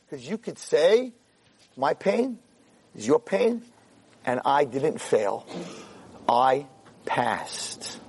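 slow, measured, with weighty pauses; solemn affirmation. Acted. Rabbi tells the girl what she could say, solemn affirmation. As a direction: let it sink to a hushed last word. Solemn words for the girl's triumph.

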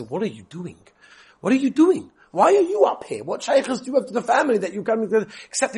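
rising indignant challenge; mild mock indignation; challenging guest. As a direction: demand to know why he is there.

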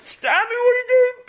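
high, loud call after someone running off. Acted. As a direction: shout after him as he grabs the bike. Friend calls after fleeing boy.

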